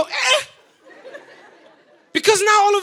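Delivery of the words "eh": sharp high "Eh" of pain; short high cry of pain. Retold or imitated. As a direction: yelp "Eh" as if poked in a sore spot. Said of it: "it just makes you go".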